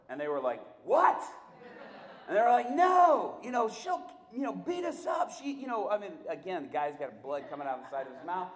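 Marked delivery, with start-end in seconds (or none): 0.8-5.9 high, loud frightened yelps; scared men refusing to let go; panicked protest. Retold or imitated. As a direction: cry out in alarm, pitched way up. Panicked men holding down woman.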